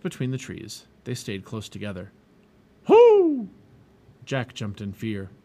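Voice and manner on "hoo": very high, very loud owl hoot. A narrator's reading. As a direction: startle them with a loud hoot. Owl hoots suddenly in woods.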